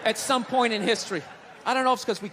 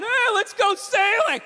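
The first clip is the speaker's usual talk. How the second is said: high, drawn-out hammed-up cheer; carefree shout; 9 semitones higher, 3 dB louder, slower than usual. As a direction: call out in mock carefree glee.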